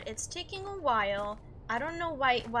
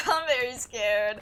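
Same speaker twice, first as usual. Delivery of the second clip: much louder, laughing through the words; 9 dB louder, slower than usual. Real, not acted. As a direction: laugh at your own fear.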